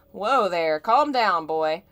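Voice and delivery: put-on a touch of a rough pilot settling a jumpy kid; mild gruff calming. Pilot calms startled Cloud, a loud hint of gruffness. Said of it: "the man muttered".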